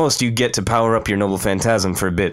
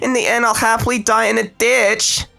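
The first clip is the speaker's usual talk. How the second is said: manic Mordred; 13 semitones higher than usual. Acted. Mordred's mad speech.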